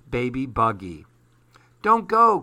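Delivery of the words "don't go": high, loud plea; child's high, loud pleading cry. Acted. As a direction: cry out for him to stay. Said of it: "cried Susie".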